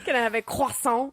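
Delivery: high, mock French accent; English with a hammed-up French accent. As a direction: put on a silly French accent.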